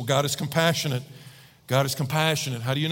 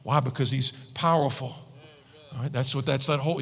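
ordinary delivery

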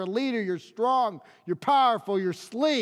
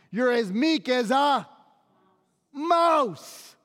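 higher-pitched, incredulous mock saying; derisive quote; 3 semitones higher, slower than usual. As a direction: quote it high and emphatic, pausing before "mouse". Today's mousy saying set against lion.

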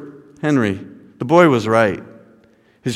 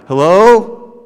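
louder, higher, rising call into a house; 7 semitones higher, 7 dB louder than usual. A narrator's reading. Chaplain at the open door, calling out. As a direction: call out questioningly for anyone inside.

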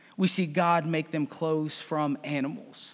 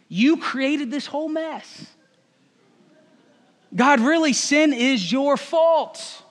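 higher, slow accusing whine; Adam accusing God; 7 semitones higher, 3 dB louder, slower than usual. Acted. Adam accusing God of the whole mess, slow high whine. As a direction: drag it out, pinning it on God. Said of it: "The man replied".

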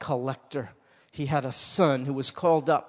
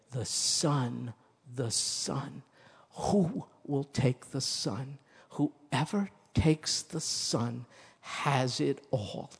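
soft, slow, weighty pulpit plea; hushed, earnest altar call; solemn preaching appeal; 5 dB quieter, slower than usual. Real, not acted. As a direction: let each phrase land gently, with long pauses.

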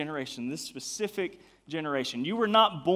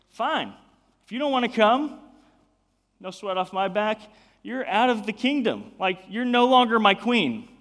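louder, higher mock-angry king dismissing his queen; upset king; 5 semitones higher, 7 dB louder than usual. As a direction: play the offended king, pushing it up and out. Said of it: "the king gets upset".

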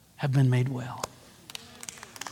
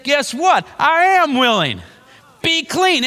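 excited exclamation; 8 semitones higher than usual. Acted. Voicing Jesus healing the leper.

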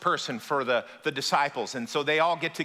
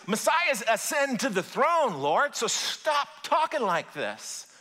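high-pitched, insistent arguing, building higher; acted rebuke; 6 semitones higher than usual. Peter arguing with Jesus about messiahs, in high-pitched rebuke. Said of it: "It says Peter rebukes Jesus".

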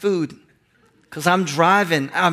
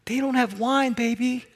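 higher, softer mom hinting sweetly; gently suggesting mom; 6 semitones higher, 5 dB quieter than usual. Retold or imitated. Mother Mary hinting to Jesus about the wine, higher and softer. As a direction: drop a gentle hint, don't command. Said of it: "She's more like".

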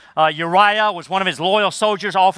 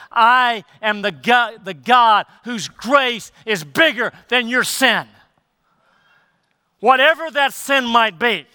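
emphatic voice of God; 5 semitones higher than usual. Acted. Preacher voicing God to the congregation, emphatic and pleading. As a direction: press each word home like God pleading. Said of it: "Is he not so emphatically saying".

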